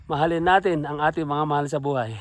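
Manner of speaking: ordinary delivery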